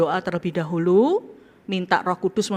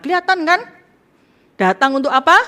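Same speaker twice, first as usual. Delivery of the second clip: preacher quiz; 9 semitones higher, 9 dB louder, faster than usual. Real, not acted. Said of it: "preacher quizzing congregation".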